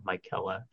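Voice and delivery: "Michaela" said softly as my-KELL-uh; "Michaela" with a long i and hard k; another pronunciation guess. Shown as a demonstration. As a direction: try "Michaela" starting like "Mike". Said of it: "however they're gonna pronounce it".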